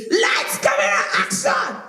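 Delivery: strained shout from the throat; throaty shout. Shown as a demonstration. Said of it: "shout from your throat".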